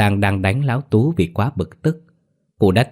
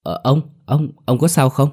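high, halting, anxious question; worried; 6 semitones higher than usual. A narrator's reading. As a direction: stammer with concern for him. Rushing to the fallen old man.

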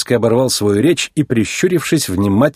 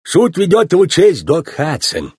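raised, put-on voice of a court official; sheriff announcing judge; 7 semitones higher than usual. A narrator's reading.

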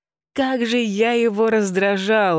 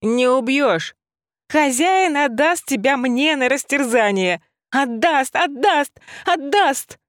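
higher and higher, louder gloating yell; loud taunting shout; 8 semitones higher than usual. A narrator's reading. Heroine shouting taunts while dodging debris. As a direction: shout back, rising with each repeat. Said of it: "громко кричала".

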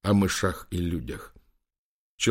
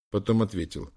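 ordinary delivery

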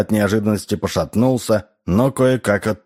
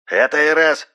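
high voice on a crackly phone line; shouted over phone; 8 semitones higher than usual. A narrator's reading. Sparks announces a caller, shouting over the phone. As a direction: call out down a bad line. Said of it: "прокричал в трубку Спакс".

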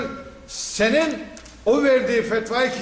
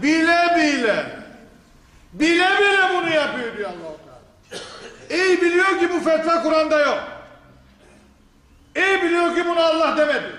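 higher, building fervour; rising emphatic preaching; 7 semitones higher than usual. Acted. Preacher to congregation, rising emphatic insistence. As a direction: press the point hard with rising pitch. Preacher stressing God's words.

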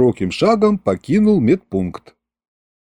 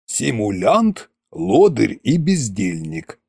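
dismissive king; 3 semitones lower, 3 dB quieter, slower than usual. Acted.

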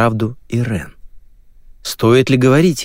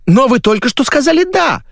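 very high-pitched protesting caller; insistent woman; 4 dB louder than usual. Acted. TV woman reminding him he agreed, insistent. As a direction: object in a much higher woman's voice. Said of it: "напомнила женщина".

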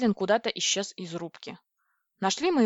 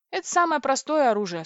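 proud; 6 semitones higher, 4 dB louder than usual. A narrator's reading. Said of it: "сказал Дрык не без гордости".

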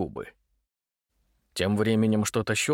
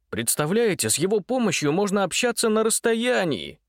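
excited; 9 semitones higher than usual. A narrator's reading. Митя enthuses about telephone.

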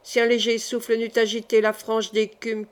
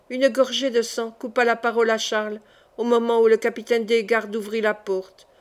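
plain reading voice

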